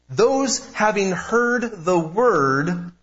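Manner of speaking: very slow and weighty, stretching out "heard the word"; emphatic phrasing. Shown as a demonstration. Preacher showing congregation Luke's phrasing, slow emphasis on "heard the word". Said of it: "what Luke is emphasizing here with the way he puts it".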